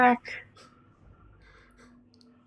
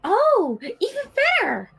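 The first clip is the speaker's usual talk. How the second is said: delighted; 7 semitones higher, slower than usual. Acted. Delighted character.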